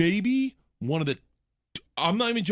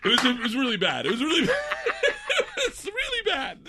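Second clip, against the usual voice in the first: high, laugh-broken retelling. Real, not acted. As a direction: fight through laughter at a grim memory. Laughing while recounting bad story.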